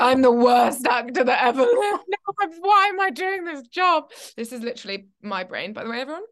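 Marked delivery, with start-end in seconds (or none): 0.0-4.1 loud high wail, laughing through it; hammed-up anguish of rejection; mock despair. Actor after a thousand no's, loud mock wail. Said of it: "And you think".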